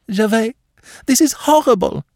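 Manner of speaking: high-pitched horror; horrified. A narrator's reading. Madame protests to Gervais, horrified. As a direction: cry out in a high, distressed woman's voice. Panting woman dreads what is coming.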